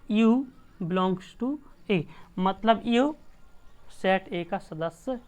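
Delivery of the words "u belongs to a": slow dictation. Shown as a demonstration. Teacher reads notation aloud.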